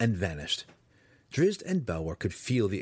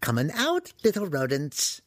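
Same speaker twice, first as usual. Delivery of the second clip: high-pitched batty wizard calling; 7 semitones higher than usual. Acted. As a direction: call out high to hidden vermin as a crazed hermit. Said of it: "the human called in a language".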